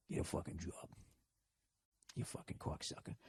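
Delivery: very soft, low mumble to yourself; mumbling. Acted. Worker freaking out coworkers, mumbling. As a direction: mumble curses barely above a breath. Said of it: "just mumbling".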